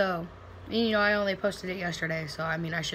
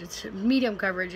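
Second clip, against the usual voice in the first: usual speaking voice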